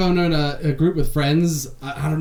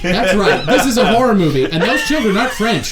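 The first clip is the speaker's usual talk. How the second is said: laughing; 3 semitones higher, 6 dB louder than usual. Real, not acted. Laughing joking continuation.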